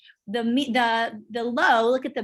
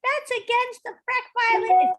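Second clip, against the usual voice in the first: much higher, louder mocking voice of a confused agent; high, loud parody of a bungling agent; clueless agent; 11 semitones higher, 5 dB louder than usual. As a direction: ham up someone botching the rules. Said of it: "I have heard".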